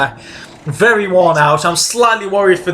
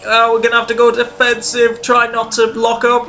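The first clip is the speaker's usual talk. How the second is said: higher pitched, urgent commentary; tense excitement; 6 semitones higher than usual. Real, not acted. Gamer defending from Massa, higher urgent excitement. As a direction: sound the alarm as he closes.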